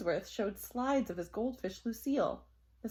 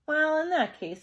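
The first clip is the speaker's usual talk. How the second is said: fussy principal; 7 semitones higher, 8 dB louder than usual. Acted.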